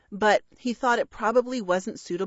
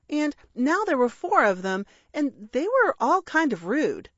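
plain reading voice

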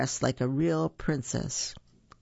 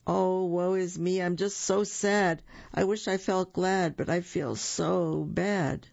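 higher woeful moan, slowing at the end; moaning bear; 4 semitones higher than usual. Acted. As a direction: groan it out like a sulky cartoon bear. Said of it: "Buford moaned".

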